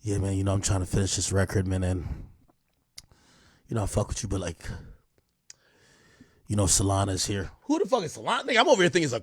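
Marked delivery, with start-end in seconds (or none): 0.0-7.5 imitating [name].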